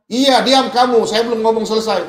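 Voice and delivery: loud, high, cutting in; retold angry scolding. Imitating the angry man refusing interruption, loud scolding. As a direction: shout down the other speaker in mock anger. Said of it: "kok marah-marah".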